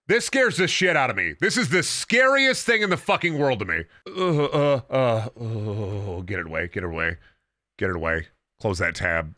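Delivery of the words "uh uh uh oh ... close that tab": low, hushed, panicked recoil; phobic panic. Real, not acted. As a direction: shrink away from the thing on screen. Recoils from clown picture on screen.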